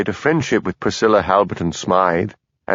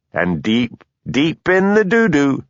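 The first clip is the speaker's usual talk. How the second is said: slow gloating sing-song, high; crowing; 6 semitones higher, slower than usual. A narrator's reading. Blair taunting Hamish before his hearing, crowing. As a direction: savour it with mocking glee. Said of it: "he crowed".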